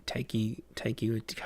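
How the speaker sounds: usual speaking voice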